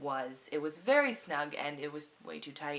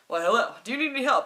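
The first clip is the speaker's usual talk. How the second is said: much louder and higher, fast eager greeting; eager salesman; 6 semitones higher, 12 dB louder, faster than usual. Retold or imitated. Retold shop assistant greeting the narrator, loud and fast. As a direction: greet her like a keen salesman. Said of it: "he was like".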